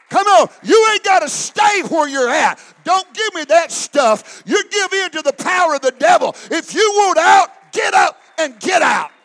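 loud, ringing preacher's shout; full-force preaching. Acted. Preacher rouses the Holy Ghost filled saints, shouting. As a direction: whip the saints up to their feet.

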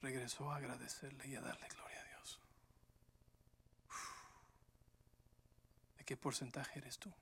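soft, near-whispered appeal; hushed reflection. Real, not acted. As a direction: lean in and murmur to each listener. (reflexión en susurro íntimo)

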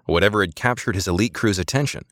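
plain reading voice

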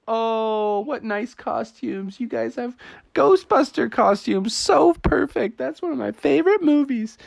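high, gushing neighbor voice; admiring neighbor. Acted. Neighbor greets costumed trick-or-treaters, admiring. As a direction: coo high over the costumes.

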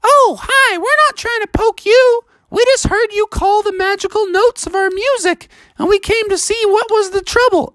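squeaky, very high sea-creature voice; friendly magical creatures in high falsetto; narwhal chorus. Acted. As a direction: reassure him in a tiny falsetto. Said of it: "And they're all like".

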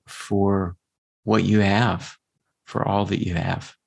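guided meditation voice. Acted.

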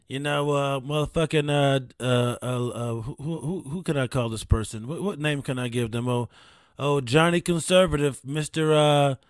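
usual speaking voice

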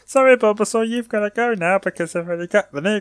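high-pitched mock cartoon hero; cartoon trainer voice. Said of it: "had the same voice".

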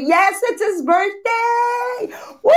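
high, slow birthday cheer; excited announcement. Real, not acted. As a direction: sing it out with joy.

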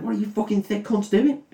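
high-pitched scolding, mock disbelief; mock exasperated Frodo. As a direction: Frodo scolding the hobbits at the fire. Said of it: "and he's like".

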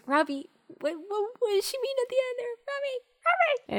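high, fast, squeaky panicked calling; frantic friend. Retold or imitated. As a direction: imitate a flustered pal pleading for answers. Imitating Travis's frantic questions.

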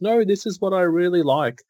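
a hint of a protesting kid; insistent child. Son's protest, voiced by parent.

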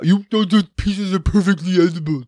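a put-on fussy voice scolding him about bread; mock prim viewer. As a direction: sniff it like a fussy critic. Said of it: "I hear you all now".

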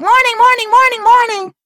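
very high sing-song mimicry; exaggerated high-pitched morning greeting; chirpy sister. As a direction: imitate an overly perky morning person. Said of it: "don't talk to my sister 'cause she's like".

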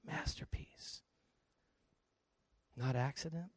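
slow, awed whisper; hushed whisper. Real, not acted. Preacher revealing to the congregation what they are, slow whisper. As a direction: whisper the word with weight. Reverent emphasis on key word, whispered.

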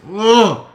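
loud, high, drawn-out groan of rage; angry groan. Real, not acted. Brother reliving the hospital night, loud enraged groan. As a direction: vent fury in one wordless burst. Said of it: "It gets me angry".

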